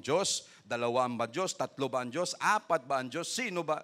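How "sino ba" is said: normal talking voice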